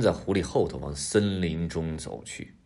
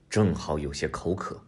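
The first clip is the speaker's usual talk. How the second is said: ordinary delivery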